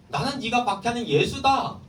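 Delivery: Jesus voiced high and forceful, pressing Saul; strong questioning voice. Teacher voicing Jesus's strong demand to Saul, forceful. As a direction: ring out each word with authority. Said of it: "강하게 묻고 있는 거예요".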